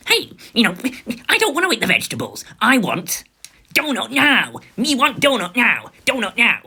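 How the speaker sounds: put-on high, loud bratty little-kid demand; child's tantrum. Four-year-old daughter demanding a donut, mock tantrum. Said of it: "she starts having a tantrum".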